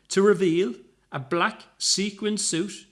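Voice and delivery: ordinary delivery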